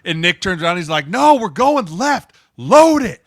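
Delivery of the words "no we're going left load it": loud, much higher yell at the line. Retold or imitated. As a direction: shout the protection call at a teammate. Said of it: "they're just yelling at each other".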